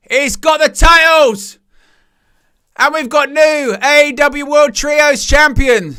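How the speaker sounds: loud, high cheer of a title win; hyped, high call of a new champion; excited call. Real, not acted. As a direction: shout it out as the winner is crowned. Title win called live.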